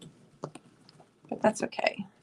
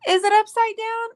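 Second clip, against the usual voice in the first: calling out; 12 semitones higher, 6 dB louder than usual. Real, not acted. Calling out to Matt elsewhere.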